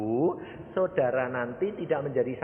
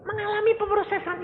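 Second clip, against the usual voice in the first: mock astonishment. Acted astonished questioner echoes the answer.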